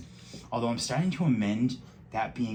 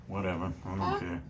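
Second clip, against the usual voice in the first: ordinary delivery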